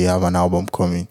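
ordinary delivery

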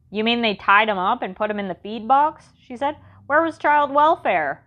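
worked up and indignant; excited. Acted. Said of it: "Imogene got all excited".

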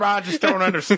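usual speaking voice

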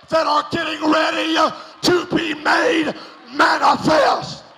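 loud, rising preacher's roar; preaching at full force. Acted. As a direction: build it up, shout every line.

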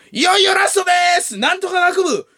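very high, loud stretched shout; all-out hype shout. Retold or imitated. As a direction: announce the big number at full blast. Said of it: "もう思いっきり俺".

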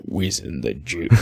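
low, slow, soft slacker impression; Stoney catchphrase. Co-host chiming in with the film's lingo, drawled impression. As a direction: stretch it out low and lazy. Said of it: "I wanna see him as Stoney".

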